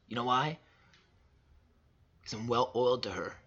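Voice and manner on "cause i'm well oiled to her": ordinary delivery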